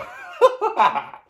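high, loud burst of laughter; laughing. Real, not acted. Laughing after his own Arnie impression, high and loud. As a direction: crack up at your own joke.

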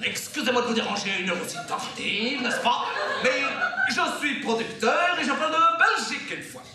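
hammed-up Belgian French on the phone; Belgian accent. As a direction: pose as a Belgian producer, accent laid on. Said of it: "Je prends l'accent belge?". (accent belge exagéré)

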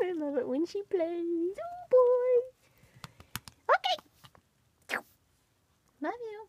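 high, loud cooing pet voice; baby talk to pets. Acted. Owner greeting her rabbits in a high cooing voice. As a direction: coo up high at the bunnies.

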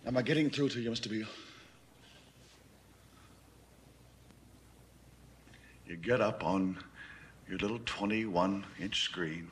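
low, measured voice with long pauses; controlled condescension. Acted. Schooling Beale on who really rules, controlled condescension. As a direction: lay it out piece by piece, restrained.

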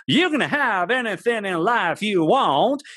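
high, booming motivational speaker's drawl; Zig Ziglar impersonation. Retold or imitated. Son imitating the tape Mum overheard, as a motivational speaker. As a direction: imitate a famous motivator's big, prominent voice. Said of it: "good Zig Ziglar impersonation".

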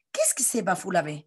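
usual speaking voice

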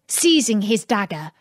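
ordinary delivery

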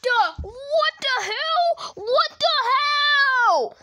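high, loud, drawn-out cries of disbelief; outraged yelling. Pretend. Player reacting to the imposter reveal, outraged. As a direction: stretch each word into a slow yell.

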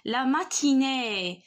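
ماتيني with the final "ee/é" held long; slow ماتيني, drawn-out ending; long final vowel. Shown as a demonstration. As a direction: stretch out the last vowel of ماتيني. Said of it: "كنطول في النطق".

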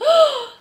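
high, loud theatrical gasp; horrified gasp. A narrator's reading. Antoinette hearing of the rats, gasping in horror. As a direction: suck in a big shocked breath.

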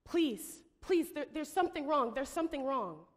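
high, loud, desperate pleading; panicking. Retold or imitated. Woman on the emergency call pleads for help, panicking. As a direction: beg for help as if terrified. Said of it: "she's panicking".

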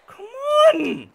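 loud, high exasperated yell. Real, not acted. Gamer yelling at his slow character to climb, exasperated yell.

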